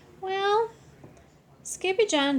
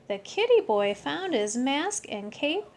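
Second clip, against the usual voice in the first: ordinary delivery, nothing marked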